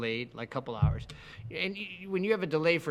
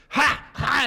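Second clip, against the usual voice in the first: sharp, loud, high barked ha ha; imitated vocal tic; 8 semitones higher, 6 dB louder than usual. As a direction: mimic a sudden involuntary tic yelp.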